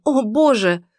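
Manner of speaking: громкий испуганный возглас; ахни в ужасе. A narrator's reading. Иден над умершей. (испуганный возглас)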